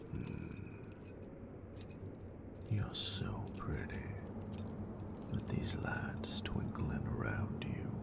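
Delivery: soft, close, teasing whisper to a lover; intimate whisper. Acted. Teasing her nerd with lights, close intimate whisper. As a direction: whisper it close, possessive and playful.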